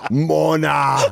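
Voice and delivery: a hint of Schwarzenegger accent, drawn-out. Trying the awkward name as suggested, mock Schwarzenegger accent. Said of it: "like, an Arnold Schwarzenegger accent".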